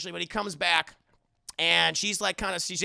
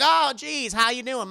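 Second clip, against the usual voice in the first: higher, bright friendly hello; cheery greeting; 5 semitones higher, 4 dB louder than usual. Retold or imitated. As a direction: greet her like a harmless good old boy.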